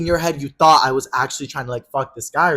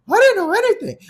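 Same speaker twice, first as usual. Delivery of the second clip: very high, louder mimicked denial; mock high protest; 5 dB louder than usual. Friend pleading ignorance to her angry pal, high mock protest.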